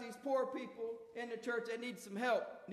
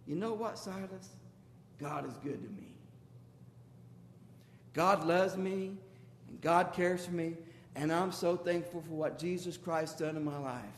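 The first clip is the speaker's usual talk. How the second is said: tender testimony; 7 semitones lower than usual. Acted. Paul telling Timothy and Silas by the fire, tender and grateful. Paul calms friends by campfire.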